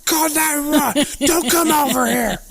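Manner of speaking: very high, loud hammed-up screech; mock yelling. Joking that they always yell at their partner, screechy mock yell. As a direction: yell it absurdly high, playing it up. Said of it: "Yell it, I always yell at her".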